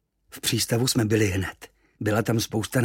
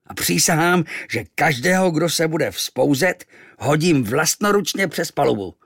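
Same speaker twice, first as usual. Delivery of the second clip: roaring captain; 3 semitones higher, 6 dB louder than usual. Acted. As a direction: thunder orders at the crew. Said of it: "zařval".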